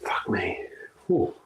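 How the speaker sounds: usual speaking voice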